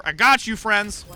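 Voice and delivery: high, loud call-out; urgent reassurance. Real, not acted. Player moving over to help friends, loud call-out. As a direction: call out to the team over the fight. Calling out to teammates in play.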